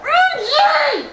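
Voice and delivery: loud, high shout of the name; exasperated shout. Real, not acted. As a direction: yell at a player who blew it. Player misses chance.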